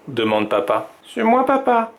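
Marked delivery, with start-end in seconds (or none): 1.1-1.9 voix d'enfant très aiguë; voix de petite fille. A narrator's reading. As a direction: fillette qui répond à son papa. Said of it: "dit Violette". (petite fille, voix aiguë)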